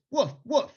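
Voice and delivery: mock dog bark, loud and high; barking woof. Shown as a demonstration. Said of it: "I'd like you all to go like this".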